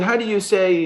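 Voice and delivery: mock the boss's German accent as he gropes for English. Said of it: "play around with this German accent".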